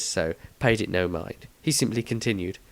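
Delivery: ordinary delivery, nothing marked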